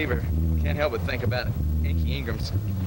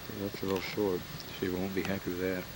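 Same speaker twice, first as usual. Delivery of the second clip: hushed golf announcer; 4 semitones lower, 8 dB quieter than usual. Pretend.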